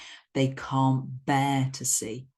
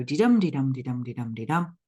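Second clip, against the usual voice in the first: "de-dum" five times, each "dum" stressed; iambic pentameter beat. Shown as a demonstration. Teacher sounding out the metre, rhythmic "de-dum" chant. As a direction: run "de-dum" as a regular heartbeat pulse. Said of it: "they follow that stress pattern".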